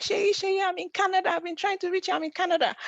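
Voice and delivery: high, eager voice of a friend calling out; excited old friend. Retold or imitated. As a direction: pitch it way up, thrilled to finally reach her. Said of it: "She was like".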